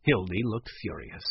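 usual speaking voice

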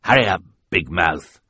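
loud, gruff growl. Acted. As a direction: growl it at the baby. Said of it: "he growled at Sunny".

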